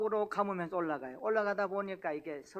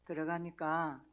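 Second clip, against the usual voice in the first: ordinary delivery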